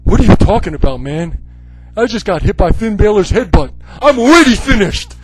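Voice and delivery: loud, exasperated protest; mock protest. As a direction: complain in mock outrage. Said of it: "And I'd be like".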